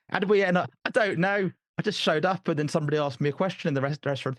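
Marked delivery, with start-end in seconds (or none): 0.1-3.2 high, bewildered bumbler; Mister Bean. Acted. Guest confessing he is no super spy, bewildered bumbler. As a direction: play it lost and clueless, pitched up. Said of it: "it's more like Mister Bean".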